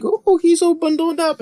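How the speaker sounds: very high, cooing mother voice; mock cooing. Retelling Rosemary reaching her baby, high mock cooing. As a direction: coo over a baby in a high put-on voice.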